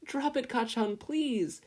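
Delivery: high desperate plea; pleading. A narrator's reading. Izuku begging Kacchan to drop the notebook. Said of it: "Izuku pleaded".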